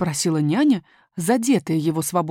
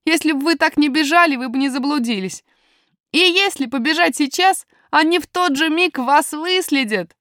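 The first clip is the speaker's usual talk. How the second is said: higher, urgent lad's voice; pitched-up, emphatic little miner; boy miner warning; 8 semitones higher, 4 dB louder than usual. Acted. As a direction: warn them firmly as a young boy.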